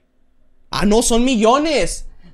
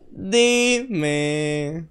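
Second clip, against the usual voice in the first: long drawn word rising at the end; drawn-out plea; 3 semitones lower than usual. Acted. Streamer coaxing a viewer to speak, drawn-out plea. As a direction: stretch it out, lower than a call.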